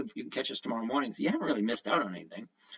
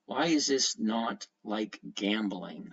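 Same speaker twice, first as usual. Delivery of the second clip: usual speaking voice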